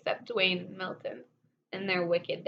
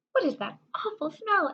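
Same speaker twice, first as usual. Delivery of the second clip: disgusted Milton; 7 semitones higher than usual. A narrator's reading. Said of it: "asked Milton".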